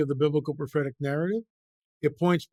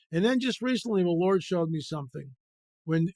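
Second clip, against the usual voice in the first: ordinary delivery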